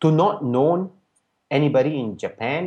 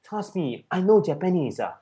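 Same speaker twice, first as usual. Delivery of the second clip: insistent imitated Japanese accent, higher pitched; Japanese-accented English; 5 semitones higher than usual. Japanese friend insisting the student is Japanese, imitated Japanese accent. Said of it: "in his kind of English".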